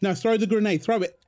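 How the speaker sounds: excited urging. Real, not acted. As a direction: push your teammate to throw now. Urging teammate mid-firefight.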